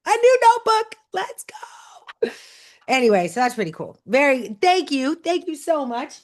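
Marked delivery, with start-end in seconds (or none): 0.1-1.0 very high, loud delighted cry; excited squeal. Real, not acted. Finds a notebook in gift. 1.1-2.3 high, excited whispered cheer. Real, not acted. Excited over gift, whispered cheer.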